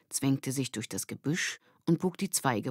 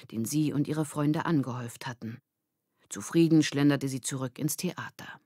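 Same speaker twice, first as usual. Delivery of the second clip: ordinary delivery, nothing marked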